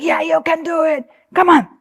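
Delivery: high, strained cheering-on; pushy coaching dad. Retold or imitated. Dad driving daughter up a snowy hill, high mimicked urging.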